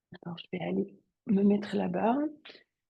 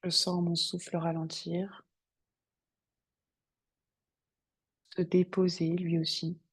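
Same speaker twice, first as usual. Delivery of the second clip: guided meditation; 2 semitones lower, 6 dB quieter than usual. Acted. Yoga teacher guiding students to settle on the mat, meditative calm. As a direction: settle them calmly into stillness, unhurried.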